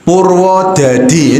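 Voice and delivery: slow heavy Javanese medok Purwodadi; Javanese medok accent. Shown as a demonstration. Teacher shows students a Javanese medok Purwodadi. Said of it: "gini, medok".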